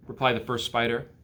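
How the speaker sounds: ordinary delivery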